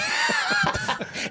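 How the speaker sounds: high, breathless burst of laughter. Real, not acted. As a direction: crack up at the jab. Co-host laughs at teasing.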